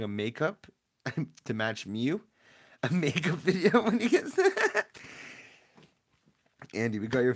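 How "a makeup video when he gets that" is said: high, loud, laughing through the words; real laughter breaking into speech. Real, not acted. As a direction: crack up while saying it. Cracks up at makeup video idea.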